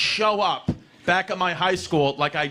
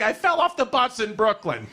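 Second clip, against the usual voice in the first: mother voice; 7 semitones higher than usual. Retold or imitated. Voicing his mother.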